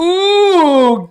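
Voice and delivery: high, loud, drawn-out ooh; big impressed "oooh"; excited ooh. Real, not acted. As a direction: whoop a long high ooh. Reacts to the hat giveaway.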